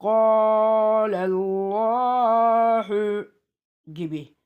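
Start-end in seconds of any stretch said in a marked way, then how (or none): 0.0-3.3 "Kâlellâhu" chanted very slowly, each long vowel held on a steady note; elongated recitation. Shown as a demonstration. Teacher demonstrating the elif stretch, "Kâlellâhu" held very long. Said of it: "Burada bir elif çekiyoruz".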